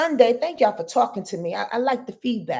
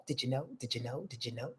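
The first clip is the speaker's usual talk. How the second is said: each repeat softer and lower, dying away; fading echo; 10 semitones lower, 18 dB quieter, faster than usual. Pretend. Teasing viewers with a fading echo, each repeat softer. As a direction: let it echo off into the distance.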